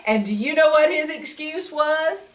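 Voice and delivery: ordinary delivery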